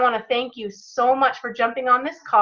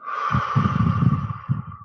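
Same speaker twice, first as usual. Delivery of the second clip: deep cleansing exhale. Real, not acted. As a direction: breathe it all out slowly. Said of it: "Ready, go".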